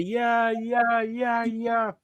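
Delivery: high, sustained drawn-out chant; Bo Dallas. Retold or imitated. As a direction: intone each word on one high held note. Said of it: "Bo Dallas does that".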